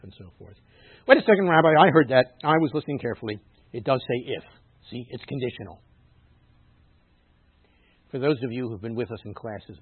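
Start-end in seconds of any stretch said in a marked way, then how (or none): 1.1-5.8 high, loud interruption, easing off; acted protest from the pews; objecting congregant. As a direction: butt in as a listener catching out the rabbi. Acts congregant interrupting the rabbi.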